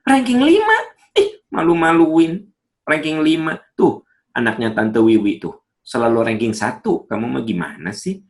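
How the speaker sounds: put-on high, loud, scornful mother comparing to another child; scolding mother. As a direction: belittle the child's ranking. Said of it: "malah ngomongnya gini".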